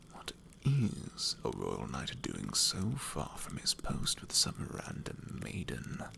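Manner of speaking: slow, hushed, prying whisper; a sly rogue whispers his suspicion about the knight. Acted. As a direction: lean in and pry in a whisper. Sly character questioning the knight.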